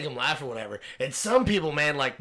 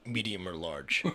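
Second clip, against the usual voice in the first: low, soft, deadpan cashier drone; imitating a fed-up drive-thru worker, low and soft; bored cashier; 9 semitones lower, 8 dB quieter than usual. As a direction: mumble it like you couldn't care less. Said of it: "He's like".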